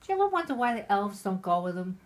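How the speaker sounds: ordinary talk, nothing marked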